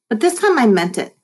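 ordinary delivery, nothing marked

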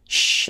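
sh, lips rounded; sh sound. Shown as a demonstration. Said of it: "no existe en español".

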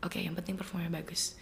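whispered inner thought. Retold or imitated. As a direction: hush it like telling herself. (berbisik pada diri sendiri)

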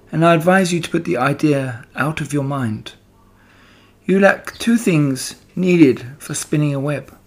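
patient spider advising the pig, kindly firm; wise spider Charlotte. Acted. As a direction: gently talk the pig out of it. Charlotte voice held.

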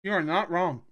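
talking with a mouthful; eating while talking; mouth full. Real, not acted. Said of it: "I'm eating food because it's my Life Day feast".

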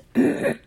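quick clearing of the throat. Real, not acted. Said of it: "I've got a frog in my throat today".

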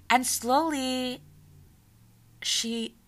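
normal talking voice

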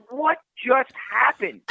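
high and slow, dazed repeat; slow disbelief. Retold or imitated. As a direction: let it sink in slowly. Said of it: "I'm like".

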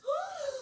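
high quick gasp; sudden gasp of dismay; sharp shocked gasp. A narrator's reading. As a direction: gasp like a child told there's no present. Yvette shocked, no presents.

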